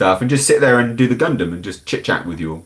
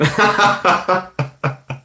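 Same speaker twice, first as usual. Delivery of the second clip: high burst of laughter; real laugh at oneself; 10 semitones higher than usual. Real, not acted. Streamer cracking up at own awful accent, laughing.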